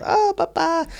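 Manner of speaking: put-on very high, pitiful child voice; little boy voice. Burned little boy answers his papa, in a high child voice. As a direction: play a tiny child far above your range. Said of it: "this little boy comes in".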